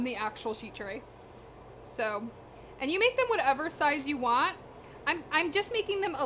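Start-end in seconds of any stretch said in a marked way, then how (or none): none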